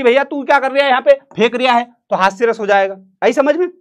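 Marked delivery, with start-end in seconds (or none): 0.0-1.8 teasing put-down. Retold or imitated. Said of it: "उसको वही करना पड़ेगा".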